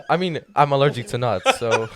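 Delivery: talking through laughter; laughing. Real, not acted. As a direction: chuckle the words out. Laughing at his own joke.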